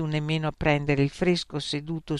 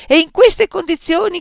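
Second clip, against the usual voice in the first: furious, much higher and louder shout; 11 dB louder than usual. Acted. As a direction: blow up at the student. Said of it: "gridò".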